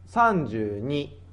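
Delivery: normal talking voice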